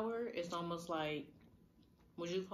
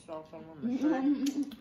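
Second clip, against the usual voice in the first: laughing; 6 semitones higher, 9 dB louder, slower than usual. Real, not acted. Laughing at spilling sauce.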